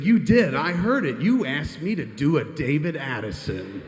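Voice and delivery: high, loud opening that eases down; imitating an indignant boss; accusing insistence. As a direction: pin the blame on him emphatically. Acted quarrel, boss insisting.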